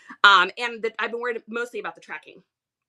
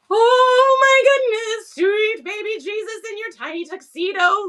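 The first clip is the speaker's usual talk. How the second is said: overjoyed, pleading to the heavens; gushing excitement; 9 semitones higher, 8 dB louder than usual. Real, not acted. To viewers about skipping the post office, squealing with joy.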